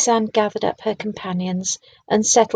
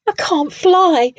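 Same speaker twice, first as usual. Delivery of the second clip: higher, slow, woeful wail; a hurt little plea; cried unhappily; 8 semitones higher, 4 dB louder, slower than usual. A narrator's reading. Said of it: "Hubert cried unhappily".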